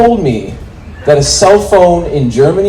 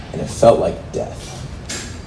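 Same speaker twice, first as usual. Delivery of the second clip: slow, unvoiced whisper of doom; ominous whisper; 4 semitones lower, 9 dB quieter, much slower than usual. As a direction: breathe it out slowly like a horror narrator.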